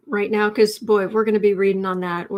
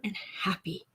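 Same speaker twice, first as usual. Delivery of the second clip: whispered; 3 semitones lower, 12 dB quieter than usual. Real, not acted. Hushed after laughing off grave.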